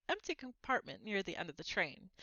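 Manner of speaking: ordinary delivery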